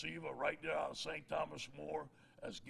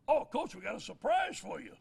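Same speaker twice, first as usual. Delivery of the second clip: higher, louder, playful voice; teasing surprise; 8 semitones higher, 6 dB louder than usual. Retold or imitated. Recruit's family teasing coach about the chili, playful. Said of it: "He goes".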